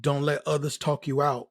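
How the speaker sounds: usual speaking voice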